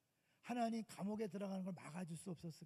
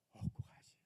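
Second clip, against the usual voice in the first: whisper. Acted. Preacher whispering the answer to the youth, hushed. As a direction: breathe the answer as a secret.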